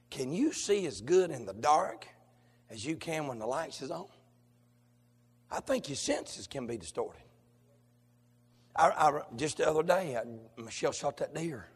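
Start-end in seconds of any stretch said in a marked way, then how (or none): none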